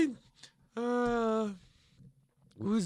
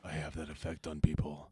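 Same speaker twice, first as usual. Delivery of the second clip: gruff cop voice; 7 dB quieter, faster than usual. Acted. GM voices Burnett on phone.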